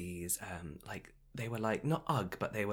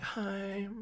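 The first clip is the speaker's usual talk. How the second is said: high, small mimicked hello; nervous greeting; 11 semitones higher, 4 dB quieter than usual. Shop worker going over to a star with bad news, timid. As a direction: imitate a timid, squeaky approach.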